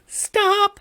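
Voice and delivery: sudden very high-pitched "Stop."; high squeaky stop. Acted. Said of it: "And so I am saying".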